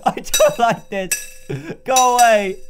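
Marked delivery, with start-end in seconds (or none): 1.8-2.6 loud, higher scared go away; first take loud and alarmed; frightened shout. Real, not acted. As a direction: shoo it off in panic. Animatronic eye at the hole.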